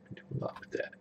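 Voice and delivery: whispered repeat under the breath. Real, not acted. Casting a match, repeats quietly.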